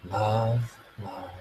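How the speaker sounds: hushed, slow love on the breath; soft mantra. Shown as a demonstration. Coach guiding a breath then soft love mantra. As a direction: murmur it after a breath.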